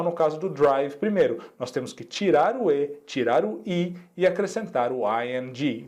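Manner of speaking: normal talking voice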